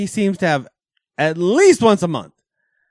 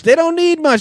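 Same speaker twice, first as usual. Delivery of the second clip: They don't need much, pitched way up and louder; emphatic high repeat; 13 semitones higher, 6 dB louder than usual. Real, not acted. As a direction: say it again, high and insistent. Repeats the line much higher.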